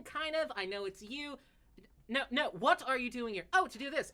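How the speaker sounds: high, fast babbling explanation; rambling excited elf. Acted. Elf explains time paradox to Melna, high fast rambling. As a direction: babble it out in a rush.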